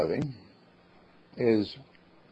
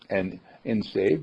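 ordinary talk, nothing marked

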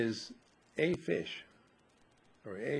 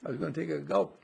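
lightly voiced fish, jumping much higher then settling; a fish suddenly remembering, up high; a hint of a startled fish; 7 semitones higher than usual. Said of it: "the fish looks over and says".

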